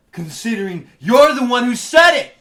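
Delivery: loud accusing jab; accusing outburst. Acted. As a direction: fling the accusation at her. Accusing teacher in heated argument.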